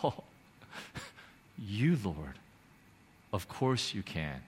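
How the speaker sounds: soft, low, yielding prayer; hushed surrender to the Lord; humble confession. Retold or imitated. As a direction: breathe out the realization, then confess quietly. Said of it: "in that confession of uttering".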